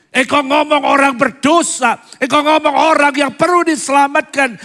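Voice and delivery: high, loud, driving sermon voice; full-force preaching. Acted. Pastor urging believers to bring family, loud fervent preaching. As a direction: pour it out over the congregation.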